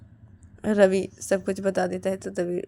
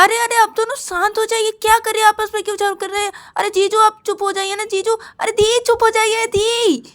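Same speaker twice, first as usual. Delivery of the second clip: very high, loud sustained pleading; pleading shout; 14 semitones higher, 7 dB louder than usual. Acted. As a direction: shout over a quarrel to stop it. Ravi shouts over quarrelling pair.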